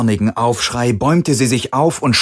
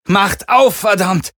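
furious, much higher shout; angry; 11 semitones higher, 5 dB louder, slower than usual. Acted. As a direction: yell to be let out. Trapped, pounding on the door.

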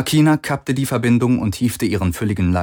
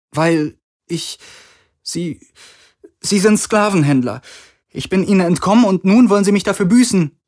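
high, halting start, then rushing out; stammer, then blurt it out in fear; frightened; 11 semitones higher, 4 dB louder than usual. A narrator's reading. Kirai explains to Galen why they want her, frightened and high. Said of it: "die Furcht in ihren riesigen Augen".

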